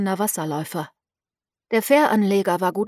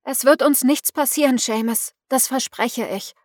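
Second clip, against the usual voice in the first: urgent reassurance; 4 semitones higher, 4 dB louder than usual. A narrator's reading. Miranda urging fisherman.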